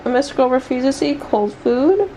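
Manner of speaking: ordinary talk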